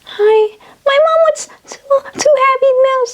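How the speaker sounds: put-on very high small child voice; kid voice. Child ordering Happy Meals for mom, squeaky kid voice. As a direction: pipe up like a little kid at the speaker. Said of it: "it's their kid talking like".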